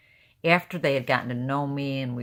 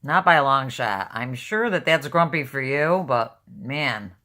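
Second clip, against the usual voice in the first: usual speaking voice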